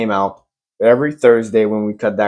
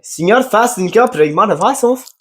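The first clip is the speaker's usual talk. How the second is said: high, drawn-out gibberish in a put-on foreign tongue; mock foreign speaker; 10 semitones higher than usual. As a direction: imitate a foreigner, pitched up and slow. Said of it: "And I, I say it in English".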